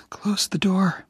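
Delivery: high, firm whisper giving an order. Acted. Dying Jenny orders Oliver to shut the door, whispered. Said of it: "Jenny ordered".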